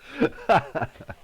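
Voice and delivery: short high burst of laughter; last leftover burst of giggling; real laugh. Real, not acted. Streamer still tickled by accent joke, laughing.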